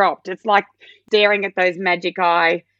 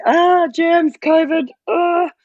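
high, loud mock panic; germ panic; 10 semitones higher, 4 dB louder, slower than usual. Mother watching her daughter touch banisters, mock panic. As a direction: squeal in mock horror at the germs. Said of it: "you're thinking".

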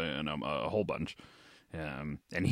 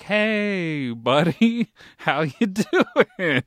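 high, loud, slow fake-friendly hello with a laugh; mock cheery greeting; 8 semitones higher, 5 dB louder, much slower than usual. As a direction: greet him like an old pal you're bluffing. Faked greeting to forgotten birthday.

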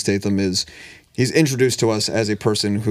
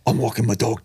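a lightly put-on English Statham accent. Friend tries his Statham accent for the podcast crew, lightly voiced. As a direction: Statham accent attempt, then breaks off. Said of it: "Your best Statham accent".

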